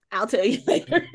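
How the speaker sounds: amused, laughter cutting in; laughing. Real, not acted. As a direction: giggle breaking mid-sentence. Giggling over the derailed topic.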